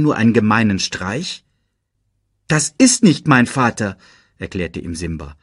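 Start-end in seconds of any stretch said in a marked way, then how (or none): 2.5-4.0 raised, louder objection; disappointed protest. A narrator's reading. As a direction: protest in a higher voice. Disappointed Simba protests to Rafiki. (enttäuscht protestieren)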